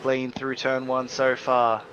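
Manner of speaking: loud, excited play-by-play; race caller. Real, not acted. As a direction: keep the energy up through turn one. Live race commentary, first corner.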